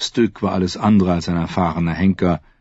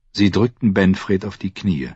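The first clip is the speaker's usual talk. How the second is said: plain reading voice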